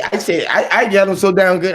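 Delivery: ordinary talk, nothing marked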